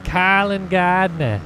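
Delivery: slow, drawn-out New Yorker take on "Carlin Gardner"; New Yorker accent. Retold or imitated. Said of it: "the New Yorker accent with that".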